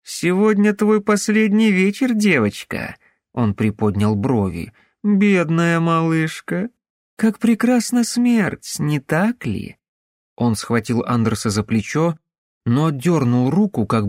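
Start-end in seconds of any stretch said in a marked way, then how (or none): 0.0-3.0 menacing taunt. Acted. Killer taunting the child. 5.0-9.8 creepy mock pity. Killer gloats over child.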